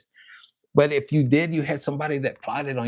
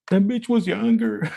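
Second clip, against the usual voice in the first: higher, louder mock mom, bitter; mom's voice; 5 semitones higher, 4 dB louder than usual. Mom explains why this cheating hurt, higher mock bitterness. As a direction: spit it like a scorned mother. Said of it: "Where's that picture right here?".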